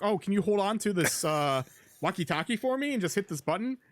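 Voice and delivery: high, breezy friendly request; friendly ask. Retold or imitated. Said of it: "And he's like".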